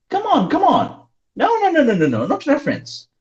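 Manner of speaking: excited teacher prompting; excited urging. Real, not acted. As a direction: urge the student on, pitch leaping up. Teacher urging student to answer.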